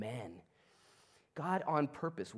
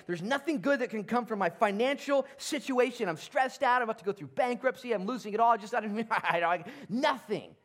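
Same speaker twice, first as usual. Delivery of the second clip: higher and louder, fast and frantic; stressed-out voice; 7 semitones higher, 4 dB louder than usual. Acted. A worried churchgoer about finances, frantic. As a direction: babble like someone losing it all.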